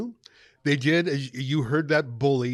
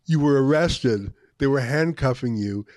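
normal talking voice

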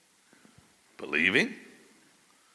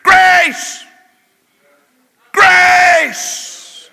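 high, loud cry of Grace, each word held long; shouted cry; 20 dB louder than usual. Acted. Zerubbabel crying out to the gravestone, high and loud. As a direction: fling the word Grace out like a proclamation.

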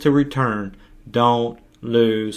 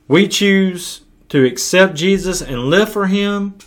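preaching; 8 semitones higher, 4 dB louder than usual. Acted. Preaching climax on choice.

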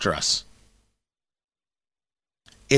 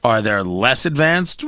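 ordinary talk

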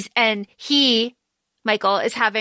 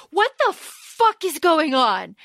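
mild mock bewilderment, higher and drawn out; slow, lightly acted exasperated question; baffled demand; 4 semitones higher, slower than usual. [name] turns to Celine in the car, mock bewildered demand.

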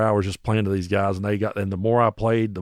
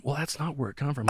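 soft, high imitated aside; muttered objection; 5 semitones higher, 10 dB quieter than usual. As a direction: keep objecting quietly from the back. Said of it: "he's back there going".

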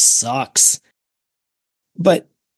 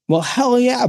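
excited; 10 semitones higher than usual. Retold or imitated. Excited answer to kids.

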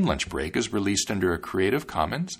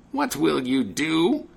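high, slow, indignant witch; angry witch; 6 semitones higher, slower than usual. A narrator's reading. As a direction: shriek at him as the witch. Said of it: "the witch cried angrily".

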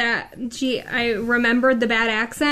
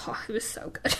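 whisper; 10 dB quieter, faster than usual. Real, not acted. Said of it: "hushed aside".

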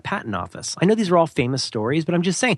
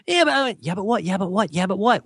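mock whiny excuses; 6 semitones higher than usual. Mocking excuse-maker's yeah-buts.

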